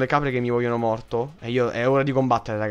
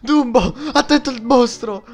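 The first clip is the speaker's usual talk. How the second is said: high, loud call to Dumbo; loud warning; 9 semitones higher, 8 dB louder than usual. Real, not acted. Gamer warning his character, loud and high. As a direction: shout a warning at the screen.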